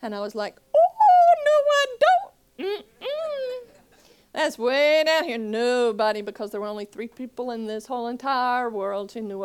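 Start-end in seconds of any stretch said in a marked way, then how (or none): 0.8-5.4 very high, loud squeal of refusal; squeaky refusal. Retold or imitated. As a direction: squeal it out like a scared girl refusing. Her own defiant refusal.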